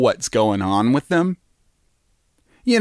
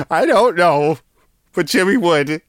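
Jimmy voice; 5 semitones higher than usual. Retold or imitated. Mimicking Jimmy's voice, laughing.

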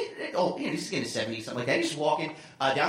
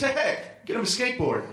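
lightly voiced joker, raised pitch; laughing old man; 6 semitones higher than usual. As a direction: imitate an old man tickled by his joke. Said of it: "he just points and goes".

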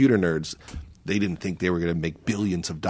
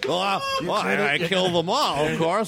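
silly high voice; 8 semitones higher than usual. Pretend.

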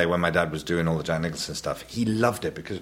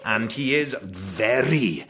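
slow, stately put-on posh Welsh voice; posh Welsh dad; 2 semitones higher, much slower than usual. Shown as a demonstration. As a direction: stretch the words with big swoops in pitch. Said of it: "he puts on a rather posh voice".

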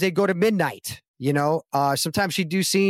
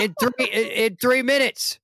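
mock disbelief, higher, slowing right down on the end; incredulous; 8 semitones higher than usual. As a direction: stretch it out, still can't believe it. Incredulous retold reply continues.

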